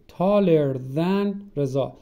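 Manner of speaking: stretched out word by word, slow; slow model sentence. Shown as a demonstration. Teacher to students, saying the example slowly.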